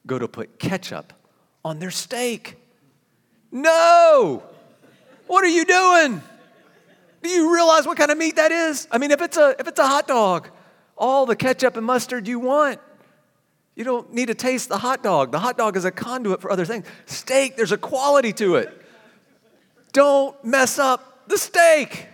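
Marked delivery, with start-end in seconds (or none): 3.5-9.9 higher, louder horrified parent cry; playful dad outrage at the ketchup bottle; mock horror. Kids putting ketchup on steak. 19.9-22.1 higher, louder, drawn-out plea; slow mock-serious shout; slow emphatic shout. Real, not acted. Passionate about steak.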